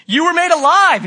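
high, loud proclaiming; joyful announcement. Retold or imitated. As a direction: tell her the good news with joy. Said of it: "I was like".

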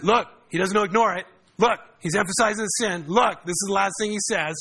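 hammed-up take on teachers pushing the sin angle; mocking the preacher who harps on sin, loud and high; imitating emphatic preachers. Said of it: "I've heard people emphasize that".

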